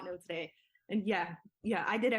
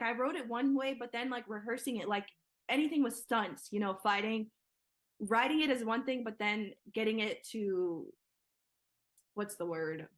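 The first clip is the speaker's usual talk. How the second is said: normal talking voice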